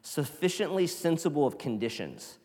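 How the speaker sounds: loud, fervent reading of the quote; dramatic reading. Shown as a demonstration. Preacher reading Annie Dillard to the church, fervent and loud, ending in a whisper. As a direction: read it like a warning to the church. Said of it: "She says".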